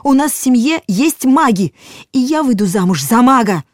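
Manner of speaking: loud, high, defiant yelling; shouting. A narrator's reading. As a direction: shout them out the door. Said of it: "кричала она".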